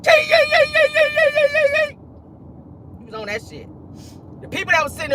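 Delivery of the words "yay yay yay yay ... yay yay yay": high hammed-up wailing; high mock sobbing wail; mock wailing cry. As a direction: wail like a mourner overdoing it. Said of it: "I'm ready to go cry".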